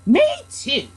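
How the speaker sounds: a hint of a young kangaroo echoing; little joey. A narrator's reading. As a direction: pipe up small. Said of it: "the young kangaroo in her pouch said".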